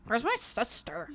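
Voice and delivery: slow, slurred-out mock question with a laugh; imitated sister. Sister wandering in asking after her sibling, mimicked slowly. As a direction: drag the words out, mimicking her.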